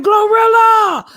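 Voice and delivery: shouting her name across the carpet; calling out. Retold or imitated. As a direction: call her name out loud, drawn out. Calling out to [name].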